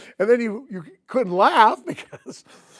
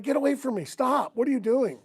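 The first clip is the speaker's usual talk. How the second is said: higher-pitched acted annoyance; retold fed-up protest; exasperated; 6 semitones higher than usual. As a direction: shoo off a pest hovering over you. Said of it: "you just wanted to kick 'em".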